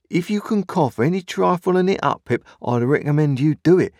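high, urgent, aghast advice. A narrator's reading. Joe urging Pip to cough up the bread, aghast. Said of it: "said Joe, all aghast".

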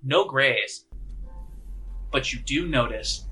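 normal talking voice

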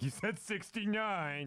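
very high, slow teasing mimicry; mock giddy squeal; imitating a juvenile friend. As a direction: drag it out in a high mock-gleeful voice. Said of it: "he'll be like".

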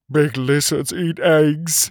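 slow, mildly fearful whimper; a touch of frightened whining. A narrator's reading. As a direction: whimper it slowly in a character voice. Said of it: "he whimpered".

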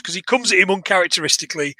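usual speaking voice

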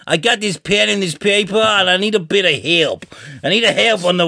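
mock American accent. Guy that really needs help, mock American accent. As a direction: put on a needy, exaggerated East Coast accent. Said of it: "I love that accent".

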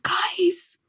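unvoiced conspiratorial whisper; hushed excited whisper to family. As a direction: lean in and whisper the news. Voice note to family.

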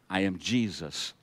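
low, soft, unhurried divine reply; quiet, measured answer from heaven; lightly voiced Jesus. A narrator's reading. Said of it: "And the voice replied".

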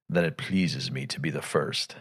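cool unhurried menace of a god; menacing Unicron. Retold or imitated. Fan imitating Unicron's calm reply to Megatron. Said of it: "Orson Welles played it well".

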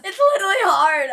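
loud, strained cry of real distress; pained outcry. Real, not acted. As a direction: blurt it out as the spice burns. Burning mouth from spicy drink.

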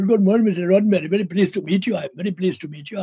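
put-on quick, polite Indian accent; imitated Indian English greeting. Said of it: "I don't actually talk like that".

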